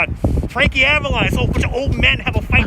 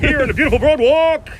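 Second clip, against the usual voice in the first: higher, louder, a showy radio DJ voice; radio announcer voice; 7 semitones higher, 4 dB louder than usual. Shown as a demonstration. Host demos his radio voice for the stream. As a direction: sell the boardwalk like a radio host. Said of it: "you do the radio voice".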